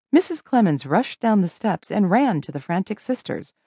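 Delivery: plain reading voice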